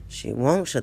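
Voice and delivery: usual speaking voice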